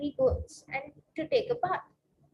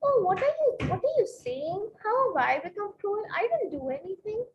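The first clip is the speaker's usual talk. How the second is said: higher-pitched, puzzled protest; acted confusion; 5 semitones higher than usual. Husband answering his wife's accusation of cruelty, confused. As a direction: play the baffled husband, voice rising in mock disbelief. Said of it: "So he's confused".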